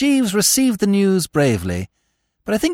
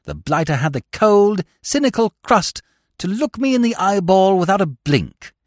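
plain reading voice

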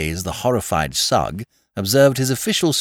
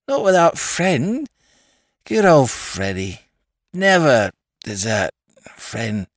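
put-on drunken toff; 3 semitones higher, slower than usual. Drunk lord refusing the inspector's taxi, slow and slurred. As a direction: slur it out like a loyal drunk. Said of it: "said Lord Peter".

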